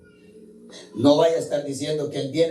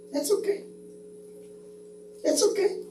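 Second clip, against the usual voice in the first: gentle consoling; 7 dB quieter than usual. Acted. Jesus consoling crying person.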